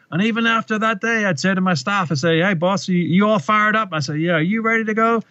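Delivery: a hint of Obama telling his staff, upbeat and high; Obama impression. Coach retelling Obama's story, light Obama voice pitched high. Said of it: "his voice changes and he goes".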